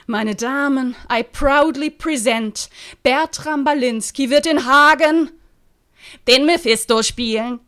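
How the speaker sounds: high, loud showman's proclamation, slowed; theatrical announcement. Acted. Actor proudly announcing his role to colleagues, grandly. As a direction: present yourself like a ringmaster.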